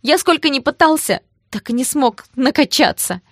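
high, loud, plaintive lament; upset, tearful. A narrator's reading. Гоша laments being teased at school, tearfully. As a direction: wail out the old hurt. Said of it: "расстроенно говорил он".

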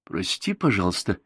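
plain reading voice

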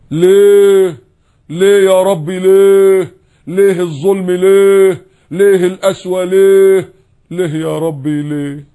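high, loud, drawn-out wail of why; hammed-up wailing at God, slowly; mock lament, high and stretched out; mock whining complaint. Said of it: "بحنية كده".

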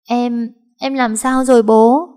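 giọng cao, to, chậm, nấc nghẹn; hỏi bố trong tiếng nấc; vừa nói vừa nấc. A narrator's reading. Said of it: "Nó vừa nói vừa nấc lên". (bé gái nấc lên hỏi bố về em)

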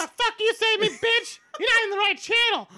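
brassy, squeaky challenge to fight. Pretend. Acted fight challenge, very high.

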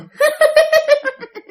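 high, creepy giggle from the throat; throaty eerie laugh. Retold or imitated. Possessed aunt suddenly laughing to herself, throaty eerie laugh. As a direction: laugh eerily inside your throat. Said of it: "Tự nhiên cười trong cái họng".

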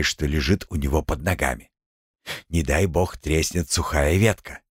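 ordinary delivery, nothing marked

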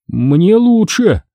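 high, drawn-out pleasant surprise; surprised. A narrator's reading. Injured Michael finding his leg is better, surprised. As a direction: say it slowly, pitched high in wonder. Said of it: "удивлённо сказал он".

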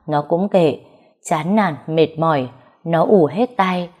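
plain reading voice

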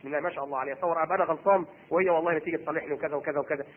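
low, soft, quick murmur of a grateful husband; lightly voiced husband, talking it over under his breath; husband marvelling at his wife, hushed and quick; husband musing to himself. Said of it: "تقول".

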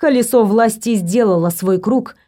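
ordinary delivery

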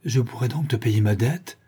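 ordinary delivery

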